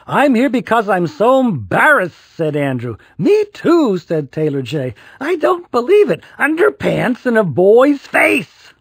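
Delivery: high, loud complaining; embarrassed wail. A narrator's reading. Boys complaining to their mums, high and loud. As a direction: whine it out of the trash can.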